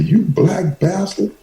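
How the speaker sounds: high, slow furious mama voice; mother's furious curse. Retold or imitated. Mother cursing her son, high and slow. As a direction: shriek it like an enraged mother.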